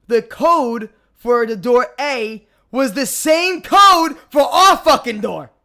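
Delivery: slow, loud, punched-out outrage; emphatic disbelief. Real, not acted. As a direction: hammer each word, building to a shout on the punchline. Emphatic punchline, outraged at host's mistake.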